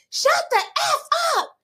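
put-on a four-year-old snapping at the teacher; kid yelling back. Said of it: "the little boy's like".

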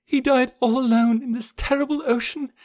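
high, breathy, anguished lament; grief near sobbing. A narrator's reading. Jane mourning his death at sea, anguished, near collapse. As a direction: start very faint and breathy, swell into grief, break off. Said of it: "sank sobbing to the floor".

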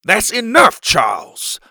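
loud, high cut-in dropping on the name; father losing patience; impatient interruption. A narrator's reading. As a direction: cut him off firmly. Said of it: "Pa interrupted, growing impatient".

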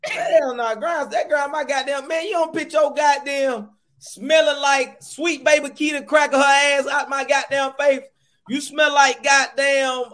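mock angry rant. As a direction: rail at them in hammed-up disgust. Comic rant at a musty person.

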